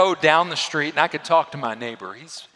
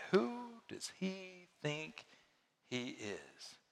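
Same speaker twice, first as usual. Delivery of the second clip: muttered scorn; 3 semitones higher, 17 dB quieter, much slower than usual. Retold or imitated. Voicing scornful religious elites.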